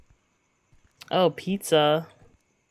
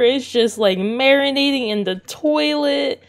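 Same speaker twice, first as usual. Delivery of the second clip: normal talking voice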